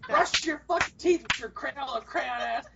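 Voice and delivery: slow, drawn-out mock geezer insult; hammed-up grumpy old-timer; yelling old man. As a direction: scold like a cranky old man. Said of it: "I would yell at everybody".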